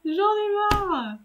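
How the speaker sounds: high, drawn-out fed-up wail; amused, high-pitched despair; exasperated whine. Real, not acted. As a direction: complain high and long, close to laughter. Fed up, laughing at her failures.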